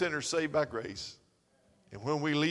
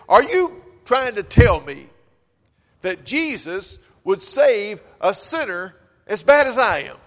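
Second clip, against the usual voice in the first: pleading disbelief; 4 semitones higher, 10 dB louder than usual. Retold or imitated. Sinner asks in disbelief.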